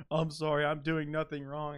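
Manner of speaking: lightly hammed crocodile teacher; mock innocent teacher. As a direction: play the guilty monster pleading innocence. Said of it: "he's just like".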